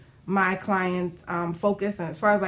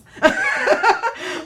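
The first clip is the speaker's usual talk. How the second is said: high burst of laughter; 14 semitones higher, 6 dB louder than usual. Real, not acted. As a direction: laugh out loud, amused. Host laughs after joke.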